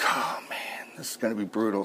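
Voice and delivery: put-on hushed, fearful kids' whisper; whispered dread. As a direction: breathe it like a scared kid. Kids dreading spanking.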